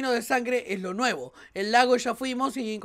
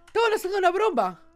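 normal talking voice

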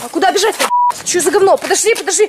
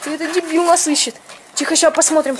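usual speaking voice

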